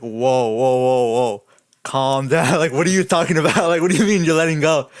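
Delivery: loud, rising protest with a laugh in it; retold panic. Novice to instructor letting go of controls, retold panic.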